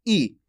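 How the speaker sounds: simple, brief "ई"; short vowel demo. Shown as a demonstration. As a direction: sound out "ई" by itself for learners. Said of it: "इतना simple उच्चारण होता है इसका". (छोटी "ई" का सरल उच्चारण)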